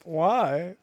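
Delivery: retold bafflement. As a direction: ask it as if stunned. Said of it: "I'm like".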